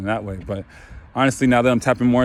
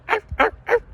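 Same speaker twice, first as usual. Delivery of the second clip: tiny, much higher puppy barks; puppy yaps. Retold or imitated. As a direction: yap like a little puppy. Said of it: "like a little puppy".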